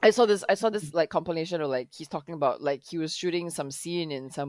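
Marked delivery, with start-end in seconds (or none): none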